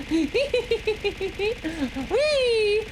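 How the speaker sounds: high squealing giggle fit; shrieking laughter. Real, not acted. Giddy after putting dress on.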